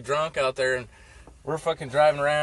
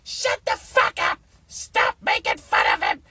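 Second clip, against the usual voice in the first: shrill, high furious screaming; hammed-up angry yell; stepmom yelling; 6 dB louder than usual. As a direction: scream it at the kids like a crazed biker mom. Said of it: "she was fucking crazy biker".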